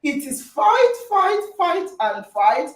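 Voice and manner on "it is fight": usual speaking voice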